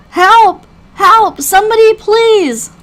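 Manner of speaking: high, loud shouting; panicked cry for help. Acted. Floating survivor flailing at nothingness, panicked shouts for help. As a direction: shout desperately for anyone to come.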